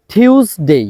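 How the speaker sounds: normal talking voice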